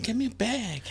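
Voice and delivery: put-on high, whiny girlfriend demand. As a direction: whine for the bag.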